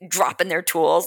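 ordinary talk

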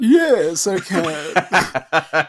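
high, loud laughing rich guy. Pretend. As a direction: ham up a pompous rich man. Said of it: "can you do this in your rich guy voice".

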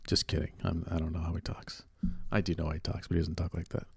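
very soft, low aside; quiet, low retraction after a joke; hushed aside. Real, not acted. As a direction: take it back under your breath. Sheepish retraction of his impression.

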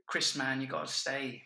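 badly attempted London accent; terrible London accent. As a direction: have a clumsy go at a London accent. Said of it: "that was a terrible accent".

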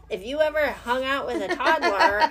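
high, loud, cracking up on the words; real laughter taking over the punchline; laughing. Real, not acted. Parent joking to a friend about toddlers, laughing.

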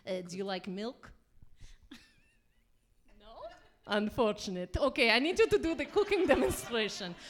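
lightly put-on bad Russian accent; hammy Russian cooking host. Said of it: "speak in bad Russian accents".

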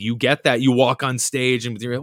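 ordinary delivery

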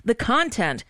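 usual speaking voice

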